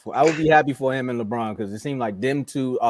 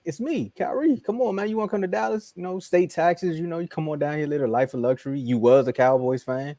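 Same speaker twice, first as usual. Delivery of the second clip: higher, coaxing imitation of Kyrie, slowing down on the pitch; Kyrie sales pitch; 4 semitones higher than usual. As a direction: sweet-talk LeBron into coming to Dallas. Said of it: "He's like".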